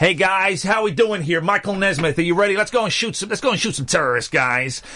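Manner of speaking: rally your online teammates in a fake American accent; playful imitation of an American. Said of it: "I start pretending I'm an American".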